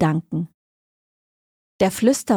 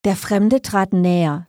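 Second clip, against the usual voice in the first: ordinary delivery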